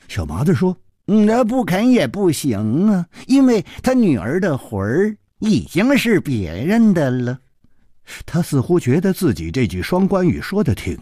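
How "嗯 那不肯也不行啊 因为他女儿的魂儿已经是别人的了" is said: put-on higher, lilting boyish reply; lightly voiced knowing boy. Boy making a pun to his friend, lightly smug. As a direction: stretch the words as if pleased with yourself. Narrator voices boy's wordplay.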